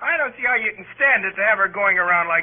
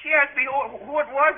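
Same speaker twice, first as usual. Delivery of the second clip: ordinary delivery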